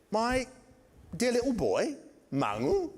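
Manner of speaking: doting headmistress objecting, pitched well up; prim headmistress. Retold or imitated. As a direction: imitate the fussy headmistress, lifted high. Headmistress protesting to father.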